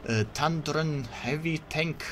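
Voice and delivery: put-on hammy Russian commander accent. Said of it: "wannabe Russian voice acting impression by me".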